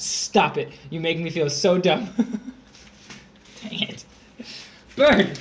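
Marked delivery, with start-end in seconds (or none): 5.0-5.4 loud. Real, not acted. Teasing, calling out.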